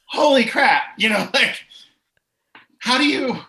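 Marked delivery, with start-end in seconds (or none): 0.0-0.8 retold shock, loud and high; stunned outburst. Floored by a friend's confrontation, stunned outburst. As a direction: blurt it as a recalled shock.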